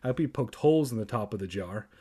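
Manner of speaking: ordinary delivery, nothing marked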